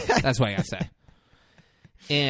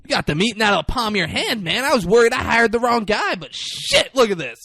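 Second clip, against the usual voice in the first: much higher, louder, fast; mock gushing praise; 10 semitones higher, 8 dB louder than usual. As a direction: rave about the new hire. Boss gushing over Pratt.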